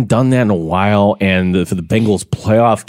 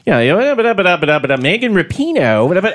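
a lightly mocked Baltimore accent, higher; Baltimore accent impression; 9 semitones higher than usual. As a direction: rattle off blah blah in a Baltimore accent. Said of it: "It's the Baltimore accent".

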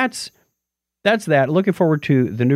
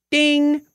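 high bright ding; idea ding; 14 semitones higher than usual. Pretend. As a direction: sing a cheerful ding. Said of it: "my phone even went ding for me".

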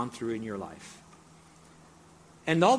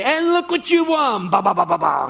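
high, loud game-show prize reveal; game show announcer; 9 semitones higher, 10 dB louder than usual. Acted. Host showing a winner the prize, game show announcer. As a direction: stretch it out big, then sing a fanfare. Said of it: "have you ever watched a game show on television?".

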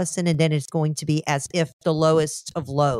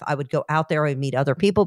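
ordinary talk, nothing marked

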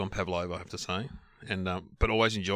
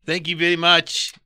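slow, higher, louder South African; South African accent; 5 semitones higher, 6 dB louder, slower than usual. Retold or imitated. Not to insult the South African winner, South African accent. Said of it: "accents that I can do is South African".

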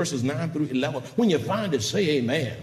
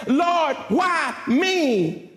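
raised, stretched plea to the Lord; complaining believer; 8 semitones higher, much slower than usual. Acted. People asking God why, complaining believer. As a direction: voice a self-pitying cry to God. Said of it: "they turn around and say".

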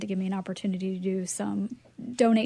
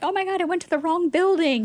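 high, quick, louder fluster; flustered panic; 8 semitones higher, 6 dB louder, faster than usual. Retold or imitated. Retelling texts with Billy about the courier, flustered panic. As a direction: replay the mix-up in a rush. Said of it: "I'm like".

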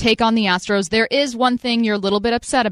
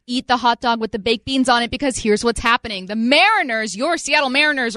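ordinary talk, nothing marked

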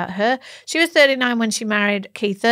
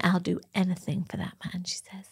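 swooning hushed whisper; breathy whisper; 9 dB quieter than usual. Retold or imitated. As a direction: whisper it like a lovestruck fan. Quoting Nicole dreamily.

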